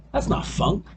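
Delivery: normal talking voice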